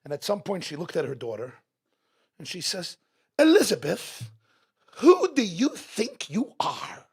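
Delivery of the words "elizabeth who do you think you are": high, loud British scolding, slowing down; acted outraged reprimand, drawn out; snapped Queen Mother. Queen Mother losing it at her daughter, angry scolding. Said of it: "So she lost it. She snapped".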